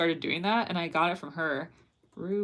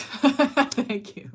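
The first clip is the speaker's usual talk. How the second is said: high laughing thanks; 6 semitones higher, 5 dB louder than usual. Real, not acted.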